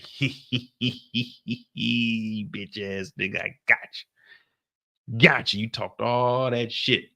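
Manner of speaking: slow mocking hee-hee taunt; gloating giggle. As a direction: savour the win with a sly giggle. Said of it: "Tariq is sitting there like".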